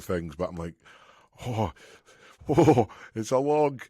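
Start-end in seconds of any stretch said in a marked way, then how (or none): 1.5-3.8 high, loud, drawn-out comic dread; mock nerves. Lifter acting out nerves about log press, mock dread. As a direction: play up the jitters with long oohs. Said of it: "I'm nervous".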